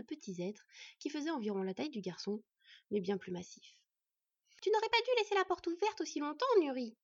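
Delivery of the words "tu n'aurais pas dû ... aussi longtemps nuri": high, loud dwarf wife; reproachful scolding. A narrator's reading. Wife to husband about the open door, shrill reproach. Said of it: "reprocha la dvergue".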